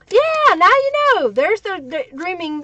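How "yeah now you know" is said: excited. Real, not acted. Excited reply to viewer comment.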